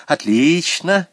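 teacher's emphatic well-done; hearty praise. A narrator's reading. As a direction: praise the pupil with gusto. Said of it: "похвалила Василиса Потапна".

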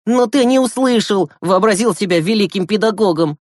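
high, accusing jab; curt retort. Acted. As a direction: throw the blame back firmly. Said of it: "отрезал Глеб".

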